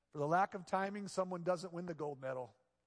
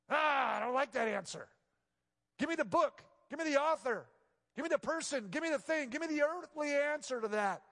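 higher, louder voice of a pushy skeptic; demanding skeptic; 10 semitones higher, 6 dB louder than usual. Retold or imitated. Visitors pressing the pastor for a method, in a high demanding voice. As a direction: demand something concrete, impatient.

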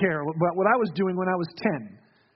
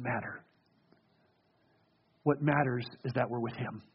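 softer, hushed sermon voice drawing listeners close; soft intimate preaching; 8 semitones lower, 6 dB quieter than usual. Acted. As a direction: preach it quietly, like a heartfelt invitation. Intimate quiet preaching passage.

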